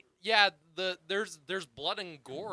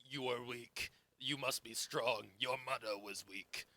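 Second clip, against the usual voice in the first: lower, softer put-on stern villain voice; mock Russell Crowe; 6 semitones lower, 9 dB quieter than usual. Host mocking the actor's villain dialogue, lower and softer put-on voice. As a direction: mimic the dull lines, lower and softer. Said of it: "all that Russell Crowe says in this movie is".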